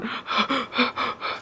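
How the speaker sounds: sharp gasp for air mid-sentence; breathless gasp. Real, not acted. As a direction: catch your breath audibly. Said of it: "I always sound so out of breath when I film".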